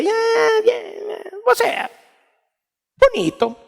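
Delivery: forced answer. Retold or imitated. Reluctant reply about retreat.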